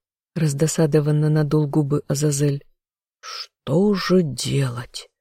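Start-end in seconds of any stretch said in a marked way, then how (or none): 3.2-5.1 long, slow mock-helpless sigh. A narrator's reading. Lover sighing over the woman he has pinned to the wall, sighing slowly. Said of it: "вздохнул он".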